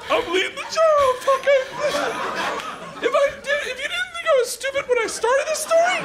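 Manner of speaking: very high-pitched comic outburst; squealing, worked-up protest; hysterical squeal. Acted. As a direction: shriek it in mock exasperation. Comic outburst about leading the show.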